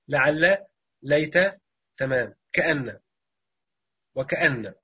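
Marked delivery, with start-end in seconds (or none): none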